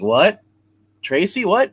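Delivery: rising, startled retold question; acted bewilderment. Dying man to his wife in a vision, bewildered. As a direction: question it in mock disbelief.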